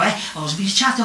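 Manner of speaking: normal talking voice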